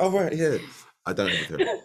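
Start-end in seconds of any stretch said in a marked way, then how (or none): 0.0-0.6 high mocking imitation of her; imitating colleague. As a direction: quote her in a higher mock voice. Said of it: "she's like".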